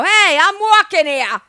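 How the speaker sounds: loud, high New York shout; New York accent. Retold or imitated. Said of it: "I look up and go".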